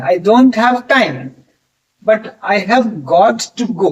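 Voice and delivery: very slow, careful English dictation; slow example sentence. Shown as a demonstration. Teacher models the have-got sentence for students, slow and careful. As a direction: lay out each word for the class.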